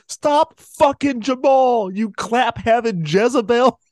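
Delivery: comedy sketch woman confronting a rival, furious; sketch rant. Retold or imitated. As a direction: drag out the outraged insult. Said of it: "that Chappelle Show sketch".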